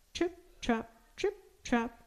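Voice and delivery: high, soft, crisp little trip-trap; light, dainty taps for tiny hooves; small high hoof sounds, softly spoken; tiny hoofsteps. A narrator's reading. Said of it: "went his tiny hooves".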